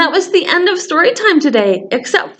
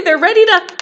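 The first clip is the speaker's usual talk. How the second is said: ordinary delivery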